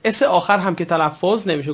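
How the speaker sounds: ordinary delivery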